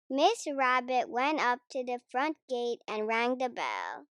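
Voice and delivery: usual speaking voice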